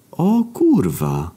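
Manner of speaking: slow, drawn-out dismay; sudden realisation. Acted. Narrator realising he forgot his fish. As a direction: freeze as it dawns.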